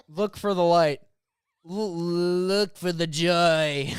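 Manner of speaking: slow, stretched presidential parody; mock-grand political voice, lifted and drawn out; Donald Trump. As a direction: draw out the last word like a hammy politician. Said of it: "I just wanna keep on s-, do-, doing Trump voice".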